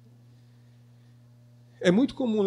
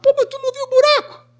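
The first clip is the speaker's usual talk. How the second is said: much higher and fast, an incredulous jab; mock exasperated scold; 3 dB louder, faster than usual. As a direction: tease someone who just fell into the hole. Mock scolding after a fall.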